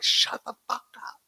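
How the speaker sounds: mock urgent whisper; hushing whisper. Charlene hushes the baby while stealing, whispered. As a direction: whisper it high, as if caught.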